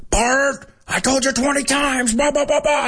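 high-pitched mock yelling cartoon dad; Homer Simpson. Homer scolding his son Bart, mock cartoon yell. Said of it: "Homer would yell at him".

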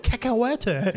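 "cacahuete" slowly, with a mock American accent; English accent in Spanish. Man mocking TikTokers who keep their English accent, slow English-accented "cacahuete". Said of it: "sigue con el acento inglés hablando en español".

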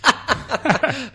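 high loud laugh; real sudden laugh; burst of laughter. Real, not acted. Reacts to podcast canceled.

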